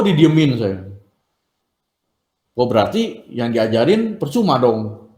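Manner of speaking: normal talking voice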